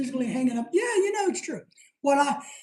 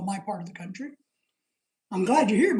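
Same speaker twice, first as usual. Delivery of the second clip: ordinary talk, nothing marked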